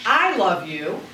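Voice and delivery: I stretched and set apart, then love you falls away; stress on I. Shown as a demonstration. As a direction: put the weight on "I". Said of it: "emphasis on the words".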